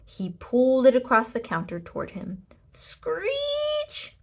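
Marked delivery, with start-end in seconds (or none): 2.7-4.2 very high screeching squeal; toaster screech. A narrator's reading. Narrator voices the toaster dragged across the counter, high screech. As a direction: imitate a toaster scraping the counter. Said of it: "went the toaster".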